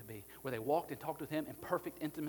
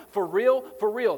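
emphatic for real; 7 semitones higher, 16 dB louder, slower than usual. Retold or imitated. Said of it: "This is Jesus saying".

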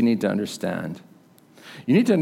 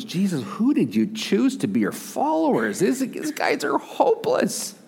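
exasperated angels; 9 semitones higher than usual. Acted. Lift up high and drag it out in dismay. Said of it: "You can imagine the angels".

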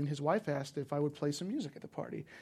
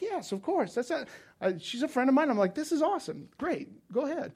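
eager agreement; 9 semitones higher than usual. Retold or imitated. Retelling his cheerful reply.

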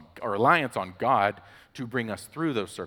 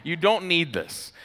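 raised, louder voice of an exasperated parent; firm parent; 7 semitones higher, 6 dB louder than usual. Retold or imitated. Dad refuses child the chlorine, imitated emphatic firmness. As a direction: shut a child's demand down emphatically. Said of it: "And I go".

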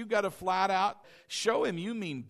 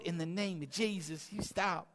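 timid rebuke; 6 dB quieter than usual. Retold or imitated. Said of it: "He's just gonna sit there".

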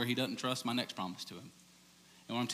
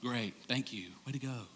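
lukewarm praise; 2 semitones lower, 7 dB quieter than usual. Retold or imitated. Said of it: "just going".

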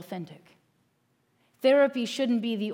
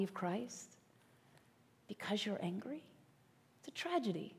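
hushed whisper; 13 dB quieter than usual. Real, not acted. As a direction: drop to a near-whisper, much softer. Grieving woman kept from church.

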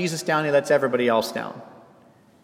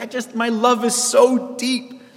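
tender gush; 8 semitones higher, slower than usual. Retold or imitated. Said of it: "to be like".

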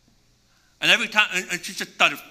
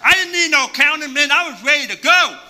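louder, higher, pumped up; hyped; 9 semitones higher, 7 dB louder than usual. Retold or imitated. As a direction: brag with full energy. Hyped about jumping.

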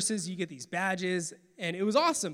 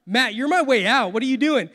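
loud, higher, exasperated cry; exasperated outburst; 4 semitones higher, 9 dB louder than usual. Retold or imitated. To his friend Matt, a loud exasperated protest. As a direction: throw it at Matt in disbelief.

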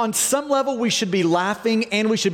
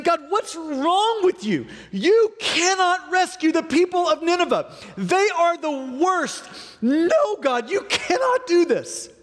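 exasperated Jonah; 7 semitones higher than usual. Acted.

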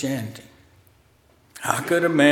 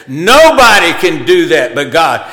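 louder, acted protest; loud objection; 3 semitones higher, 10 dB louder than usual. Scribes jumping on Jesus' words, loud protest. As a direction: object forcefully, punching it out.

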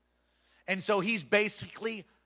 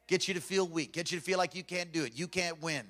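ordinary talk

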